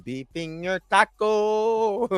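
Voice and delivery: silly elongated echo; comic drawn-out repeat. Acted. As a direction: stretch each word out playfully. Comic repeat of quoted line.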